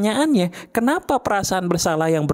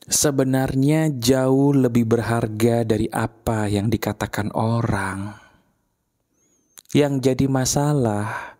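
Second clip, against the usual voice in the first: reflective guided talk; 7 semitones lower than usual. Acted. Guides listeners, eyes closed.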